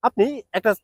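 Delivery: ordinary talk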